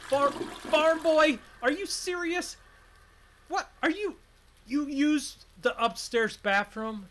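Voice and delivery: high, drawn-out mock outrage. Outraged at bathroom use.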